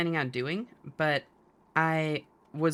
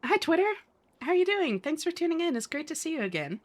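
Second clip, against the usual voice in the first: excited greeting; 10 semitones higher than usual. Real, not acted. Greeting a chatter on stream.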